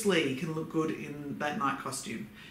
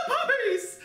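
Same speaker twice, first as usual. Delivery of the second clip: high-pitched gush over puppies; excited squeal. Real, not acted. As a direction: squeal it with delight. Gushing over puppies on the date.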